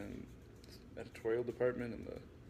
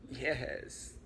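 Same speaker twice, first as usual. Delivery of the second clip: high hissing Igor voice; little Igor. Acted. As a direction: hiss it like a hunchbacked helper. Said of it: "he hissed like a little Igor".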